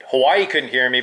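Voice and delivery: normal talking voice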